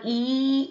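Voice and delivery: "E" drawn out long; prolonged E. Shown as a demonstration. Teacher naming the long vowel for examples, prolonged E. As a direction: hold the vowel "E". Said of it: "you have to prolong it".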